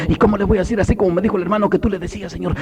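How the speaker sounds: husband praying in a rush; urgent prayer. Acted. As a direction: hurry through an anxious plea to God.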